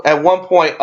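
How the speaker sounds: normal talking voice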